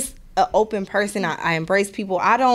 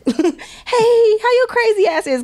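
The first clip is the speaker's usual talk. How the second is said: much higher, cheery mock greeting; friendly greeting; 13 semitones higher, 3 dB louder, slower than usual. Ex hitting her up, mock cheery and much higher. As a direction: chirp it like an old friend checking in.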